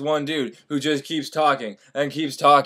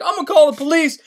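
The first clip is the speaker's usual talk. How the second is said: huffy priest; 10 semitones higher, 4 dB louder, faster than usual. Retold or imitated. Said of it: "the priest's like".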